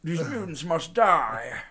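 loud opening cry, then drawn-out menace; hammed-up supervillain announcing his plan; superhero-movie villain. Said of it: "A villain from a superhero movie".

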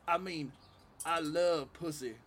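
slow imitation of an Alabama comic; Alabama accent. Retelling the open-mic comic's punchline, slow Alabama accent. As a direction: draw the words out, Alabama style. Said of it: "sounded like he was from fucking Alabama".

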